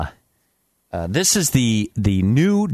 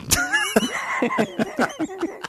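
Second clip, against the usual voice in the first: high puppet laugh; 7 dB quieter than usual. Acted.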